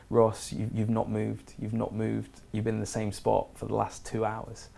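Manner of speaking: soft, reluctant delivery of bad news; subdued bad news. Captain telling the swimmer he has not moved, subdued. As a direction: quietly imitate a captain admitting it, softly.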